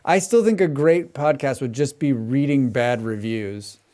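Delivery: normal talking voice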